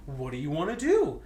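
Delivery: ordinary talk, nothing marked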